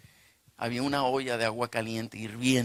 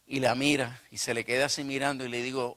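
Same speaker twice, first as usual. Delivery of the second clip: ordinary talk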